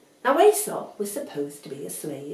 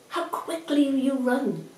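plain reading voice

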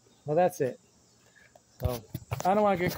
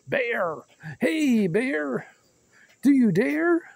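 high yelling call at a bear; scaring off a bear; 8 semitones higher, slower than usual. Pretend. Shouting at a bear.

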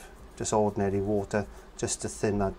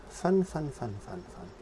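playful fading repeat; 2 semitones higher, 6 dB quieter, slower than usual. Real, not acted. Painter telling viewers it's all about fun, playfully fading away.

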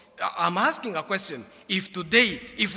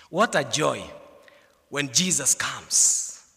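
hushed reverent close; 7 semitones lower, slower than usual. Acted. Softer closing of sermon.

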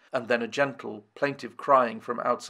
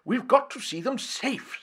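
much higher, earnest; elderly professor; 11 semitones higher than usual. A narrator's reading. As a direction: raise the voice to plead. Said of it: "said he".

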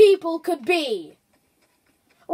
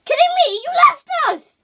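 high, loud and outraged; loud accusation; 10 semitones higher, 6 dB louder than usual. Real, not acted. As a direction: yell it, betrayed. Accusing teammate loudly.

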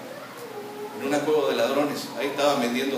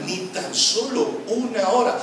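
disappointed rebuke; 6 semitones higher, slower than usual. Retold or imitated. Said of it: "Jesús le estaba dando la clave".

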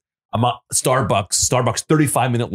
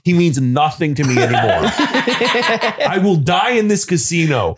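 mock threat laughing; 6 semitones higher than usual. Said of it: "I went".

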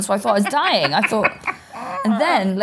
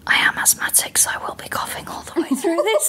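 mock confidential whisper; imitated masseuse; 10 semitones higher than usual. Masseuse warning the client of coughing, imitated whisper. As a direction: whisper it, with laughter about to break through. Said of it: "she leant down to his ear".

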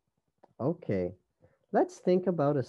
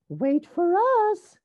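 high, loud calling voice; crying out; 6 dB louder than usual. A narrator's reading. Sisters call after Rosie's departing train, high cry. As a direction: call after someone, pitched way up. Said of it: "cried her sisters".

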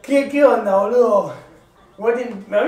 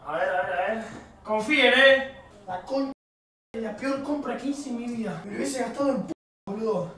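quiet grumbling; 9 dB quieter than usual. Real, not acted. Grumbles off-mic about the purchase.

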